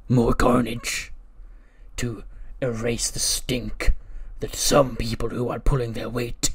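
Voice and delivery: slow, deliberate villain promo voice; menacing wrestler. Retold or imitated. Wrestler answering interviewer about his resolution, slow menace. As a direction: draw out the threat in character.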